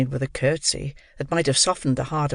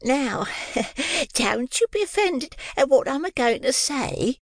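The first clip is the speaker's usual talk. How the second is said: high sugary coaxing voice; sugary high Mrs Mann; captivating sweetness; 12 semitones higher than usual. A narrator's reading. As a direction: charm him with honeyed sweetness. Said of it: "with captivating sweetness".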